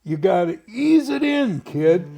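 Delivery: high, loud exasperated coaching; hammed-up exasperated operator; imitating George. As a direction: ease it in, kid, losing patience. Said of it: "and George".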